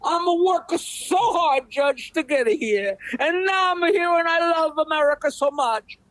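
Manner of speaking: put-on broken English, high and pleading in an Italian accent; pleading Italian immigrant. Joke: immigrant pleads to judge.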